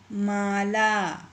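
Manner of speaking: Mala stretched very slowly, syllable by syllable. Shown as a demonstration. Teacher to pupils, sounding out Mala very slowly. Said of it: "इसी तरह से ये आगे के जो शब्द हैं".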